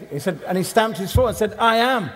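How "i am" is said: higher, louder mock huff; annoyed insistence. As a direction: stamp it out like a cross man. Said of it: "he was getting really annoyed".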